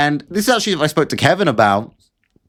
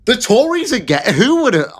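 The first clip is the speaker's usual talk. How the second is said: sarcastic disbelief, pitched much higher; mock shock; 8 semitones higher than usual. Vlogger mocking Tory news, sarcastic mock shock. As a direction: fake gasp of surprise, way up high. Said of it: "Colour me shocked".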